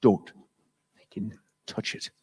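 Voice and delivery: soft, unvoiced threat; menacing whisper. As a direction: hiss a warning, barely a breath. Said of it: "he goes".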